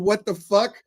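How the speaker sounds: high, loud shocked outburst. Retold or imitated. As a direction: react like you can't believe it. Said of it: "I'm going".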